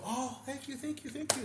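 very high gushing thanks; high-pitched chorus of mock gratitude; imitated grateful girls. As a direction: squeal it lightly, in mock girlish delight. Voicing the girls thanking him.

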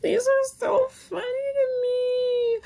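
high, slow, breaking into tears; real laughing through tears; crying and laughing at once; real tearful laugh-cry. Real, not acted. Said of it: "wow, I'm just crying right now".

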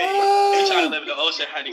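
high, loud laughing cry; shouting through laughter. Real, not acted.

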